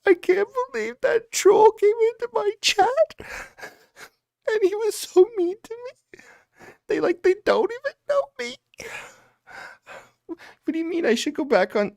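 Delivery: very high, squeaky fake crying; hammed-up blubbering, pitched right up; mock sobbing. Mock streamer crying about a troll in chat, high fake sobs.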